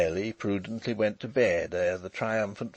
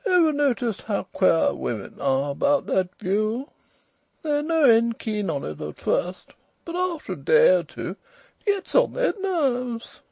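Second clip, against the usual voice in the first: drawling toff; 12 semitones higher, 6 dB louder than usual. A narrator's reading. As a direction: hold forth like a lordly toff. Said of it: "drawling, overbearing fellow with an eyeglass".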